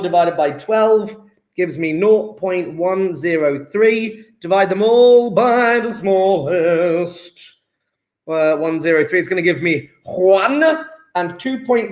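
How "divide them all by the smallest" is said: very slow, stretched-out words; drawn-out reminder. Pretend. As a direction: stretch the rule out like a chant. Chanting the step again.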